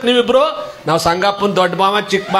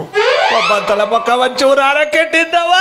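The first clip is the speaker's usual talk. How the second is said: slow, higher mourning wail, hammed up; mock lament; 6 semitones higher, slower than usual. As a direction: draw it out like a wailing mourner. (ನಾಟಕೀಯ ಗೋಳಾಟ)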